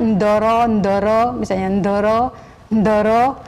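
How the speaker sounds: a light imitation of Javanese "ndoro"; Javanese medok accent. Teacher imitates Javanese speech to show medok. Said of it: "Dia kan medok".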